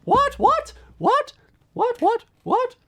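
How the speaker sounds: very high squeaked what; squeaky what. Retold or imitated. Reciting the old video's gag for viewers, high squeaky what. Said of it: "I put on a voice and I say".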